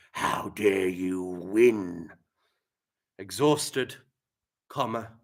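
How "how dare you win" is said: low, very slow, sinister; menacing gorilla. Acted. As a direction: growl it with malice. Gorilla threatens winner with malice.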